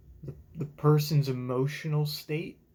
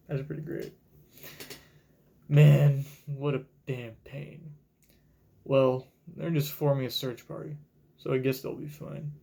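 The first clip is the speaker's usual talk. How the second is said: normal talking voice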